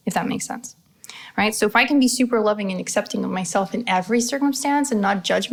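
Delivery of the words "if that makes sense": whispered aside. Real, not acted. Soft aside to viewers.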